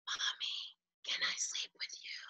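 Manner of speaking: put-on soft whispered child plea; toddler whisper. Toddler whispering to mom at night, soft whisper. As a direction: whisper it from the doorway. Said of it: "toddler whisper".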